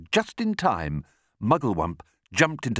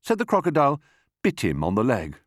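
usual speaking voice

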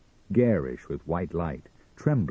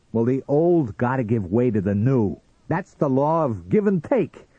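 loud, high-pitched, belligerent harangue; bullying tirade; 8 semitones higher, 6 dB louder than usual. Acted. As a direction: build to a strident peak. Sam lectures and bullies Martian.

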